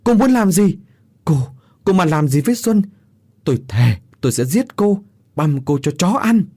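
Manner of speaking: high outburst settling into a threat; furious yell. A narrator's reading. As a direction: shout threats to protect someone. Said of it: "Hoài từ xa thét lên".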